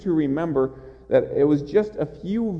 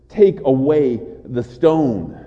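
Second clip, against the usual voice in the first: slow, loud commanding order; 6 dB louder, slower than usual. Retold or imitated. Jesus ordering the stone moved, slow loud command. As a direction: give the order like a king, slowly and loud. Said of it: "it's a tone of command".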